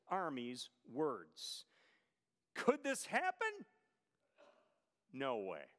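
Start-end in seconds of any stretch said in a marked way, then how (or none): 2.6-3.7 high, incredulous mock question; scoffing. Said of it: "Here's all that scoffing". 5.1-5.7 low, dismissive refusal; scoffing dismissal. Retold or imitated. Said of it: "Here's all that scoffing".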